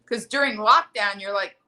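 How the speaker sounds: ordinary talk, nothing marked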